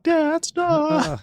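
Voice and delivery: put-on high, whiny teenage protest; whiny teen daughter. Daughter embarrassed by her dad, whiny protest. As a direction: groan it like an embarrassed kid.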